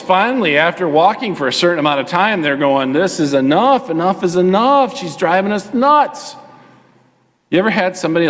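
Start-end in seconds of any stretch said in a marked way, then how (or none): 2.9-6.4 acted exasperation, rising as it goes; fed up. Disciples complaining about the yelling woman, increasingly fed up. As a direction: complain like your patience is running out. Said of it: "They were fed up".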